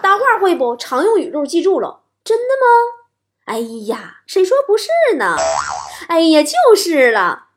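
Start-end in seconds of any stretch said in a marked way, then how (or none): 2.3-7.4 crosstalk straight man. Shown as a demonstration. Chatting with elders as straight man, hammed-up interest. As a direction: play the eager crosstalk sidekick egging on. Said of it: "你就，就捧".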